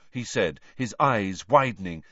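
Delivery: ordinary delivery, nothing marked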